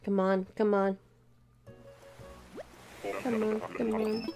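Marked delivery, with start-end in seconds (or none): none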